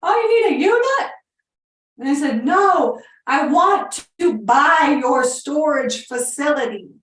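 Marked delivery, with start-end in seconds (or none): 0.0-1.1 high shouted yeah down the phone; deaf old man screaming. Retold or imitated. Deaf old owner answering his phone, shouting. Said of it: "He was screaming". 2.4-6.9 loud, high and slow, spelling it out; slow shouted offer. Retold or imitated. Caller slowly shouting her offer at a deaf owner. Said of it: "And so I started screaming".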